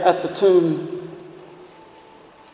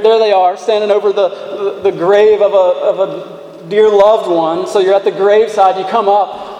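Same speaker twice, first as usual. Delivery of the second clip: ordinary delivery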